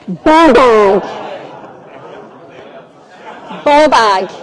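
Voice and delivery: big, booming announcement; loud proclamation. Shown as a demonstration. Host revealing the best Scots word, loudly proclaimed. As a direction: belt the word out to the room.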